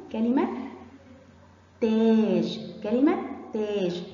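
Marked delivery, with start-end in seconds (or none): 1.8-2.6 louder, with a held aa in تاج; long aa. Shown as a demonstration. Teacher presents the next word, long aa in تاج. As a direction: stretch the vowel in تاج. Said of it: "طولت صوت التاء".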